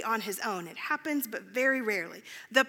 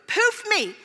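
much higher and louder, impatient pleading; demanding prayer; 7 semitones higher, 8 dB louder than usual. Retold or imitated. Late driver begging God in the car, loud and impatient. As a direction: demand the miracle loudly, almost yelling. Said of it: "This is how I was actually praying".

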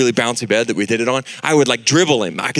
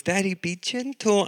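put-on little girl plea; 8 semitones higher, 6 dB quieter than usual. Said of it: "And she goes".

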